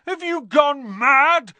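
loud, high, drawn-out yell; bellowing. A narrator's reading. Trapped boy to his dithering friend, bellowing. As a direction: bellow it in outraged disbelief. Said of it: "Ron bellowed".

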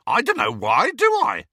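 loud retort leaping high at the end; irritated, squawking henchman; exasperated. A narrator's reading. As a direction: throw it back defensively. Amycus answers back angrily.

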